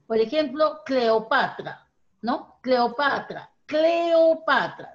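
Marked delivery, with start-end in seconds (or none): 0.9-1.9 Cleopatra said slowly, every syllable clear. Shown as a demonstration. Teacher modelling each syllable of Cleopatra slowly. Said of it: "tiene que decirse claramente". 3.7-4.9 Cleopatra slow, high and loud, syllable by syllable; slow emphatic. Shown as a demonstration. Teacher demonstrating Cleopatra slowly and firmly to a pupil. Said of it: "tiene que decirse claramente".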